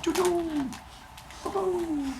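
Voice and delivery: soft, high-pitched choo-choo; train whistle imitation. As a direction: toot softly like a little train, held high. Imitates a train whistle while waiting.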